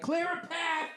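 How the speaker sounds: high mocking voice of an overprotective ref; high sarcastic ref voice; mock referee. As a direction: parody the refs clearing the way, high.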